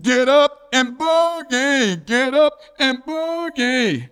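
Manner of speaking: high, stretched-out call falling at the end; singsong hollered catchphrase, held long; imitated holler. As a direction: mimic a drawn-out yell from out in the yard. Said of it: "then you heard it again".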